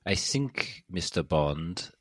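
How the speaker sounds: mock German Bond-villain voice, slowing down. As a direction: play a movie villain with a German accent. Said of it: "like a German, uh, like, villain".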